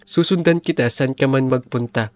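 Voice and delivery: normal talking voice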